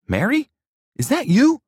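very high, loud surprise; astonished question. A narrator's reading. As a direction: realize it with a shocked shout. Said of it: "Jonathan asked".

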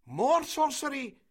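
high, loud cry of alarm; villagers shouting. A narrator's reading. Villagers crying sorcery after the shot, shouting. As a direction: shout it out to the whole crowd. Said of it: "shouted the villagers".

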